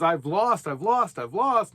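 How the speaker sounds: hammed-up wailing over losses; mock despair. A scared investor fixed on losses, mock wailing.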